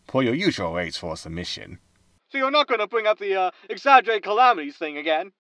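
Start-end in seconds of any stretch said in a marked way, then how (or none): 2.3-5.3 wary host. Acted. Host wary of old topic.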